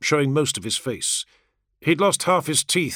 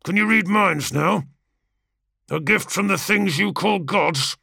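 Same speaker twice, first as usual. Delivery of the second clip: high, sharp and accusing; snapping; 6 semitones higher than usual. A narrator's reading. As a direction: bite out each accusing question. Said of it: "Kargos snapped".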